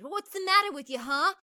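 high, hurried, mock-angry taunts; imitating boys getting worked up; enraged heckling. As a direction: badger someone in a high, riled-up voice. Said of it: "starting to get enraged".

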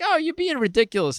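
mocking wife. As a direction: toss it off like the wife brushing him aside. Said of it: "she's like".

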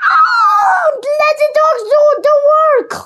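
loud, high, hurried dismissal; growled order. A narrator's reading. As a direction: dismiss them loudly, voice pitched high.

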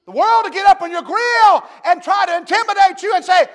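ordinary delivery

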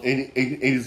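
ordinary delivery

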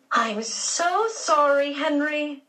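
put-on high, slow and weary, fed up with the boy; exasperated. A narrator's reading. Aunt Daisy apologising to Henry for her nephew, exasperated. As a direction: sigh it out like a tired aunt. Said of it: "she said in an exasperated voice".

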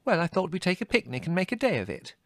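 high, light sister's voice; breezy. A narrator's reading. Margot defending her plan to Leslie, breezy. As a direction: make it sound casual. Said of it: "said Margot".

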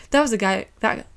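tripping over the words; stuttering. Real, not acted. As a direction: stumble on the words. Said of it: "I'm stuttering over my words".

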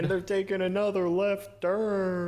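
mock race commentator. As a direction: call it like a caricature race announcer.

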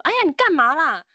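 a startled classmate suddenly calls out; sudden loud outburst. Retold or imitated. Boy suddenly blurts to possessed classmate, loud outburst. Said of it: "忽然大讲说".

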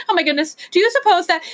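high, rising, frightened; trembling fear. A narrator's reading. Whangdoodle fearing the storm's omen, high and frightened. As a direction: gulp it out and break off. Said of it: "The Whangdoodle began to tremble".

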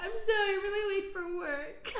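high, loud tearful whine; mock hysterical crying. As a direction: wail it like your own tearful apology, laughing. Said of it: "I just started hysterically crying".

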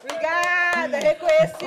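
high, loud hammed-up thanks; loud mock demand. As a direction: call out for applause, playful.

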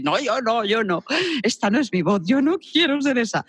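high, petulant protest; whiny refusal. Retold or imitated. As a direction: protest like a sulky child refusing. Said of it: "Y tú dices". (protesta aguda y quejumbrosa)

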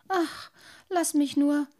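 put-on high, weary little water-boy voice; a small child too tired to go on. A narrator's reading. Worn-out boy pleads with his father, high and tired. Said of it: "sagte der kleine Wassermann müde".

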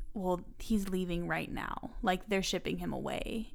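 hushed and slow, easing the bad news; a mother's softened, slow delivery; soft mom voice. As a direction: gently break it to her daughter, quietly and slowly. Mom breaking news gently.

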